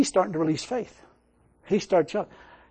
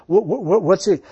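normal talking voice

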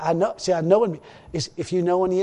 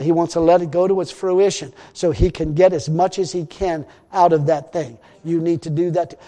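louder, driving sermon delivery, rising to a peak; full-force preaching; 7 dB louder than usual. Acted. Preacher urging the congregation to wait for harvest, loud full-force preaching.

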